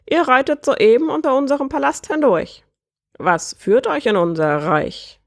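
high bird voice slowing into the question; croaking bird prince, inquiring. Acted. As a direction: squawk it as lord of the realm.

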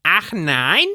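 high, sarcastic exclamation; sarcastic call. A narrator's reading. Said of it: "rief sie".

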